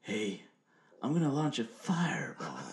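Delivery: put-on wizard with trailer-style drama; dramatic trailer-announcer wizard; movie trailer voice. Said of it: "you're doing a movie trailer".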